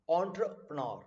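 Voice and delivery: entrepreneur as ON-truh-puh-NAR; exact pronunciation. Shown as a demonstration. Teacher correcting "entre", entrepreneur as ON-truh-puh-NAR. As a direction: open entrepreneur with a clear "on". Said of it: "pronunciation stress देने वाली बात है".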